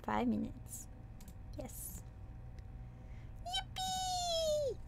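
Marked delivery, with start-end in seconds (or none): 3.5-4.8 high, soft squeaky yippee; squeaky cheer. Real, not acted. Vtuber squeaking yippee at the chat. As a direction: cheer like a cartoon.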